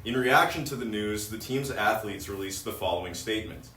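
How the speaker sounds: normal talking voice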